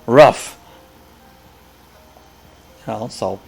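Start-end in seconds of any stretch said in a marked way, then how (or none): 0.0-0.6 loud one-word "ruff" punchline; old dog's bark. Acted. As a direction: bark out ROUGH like a dog. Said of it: "what does the old dog say".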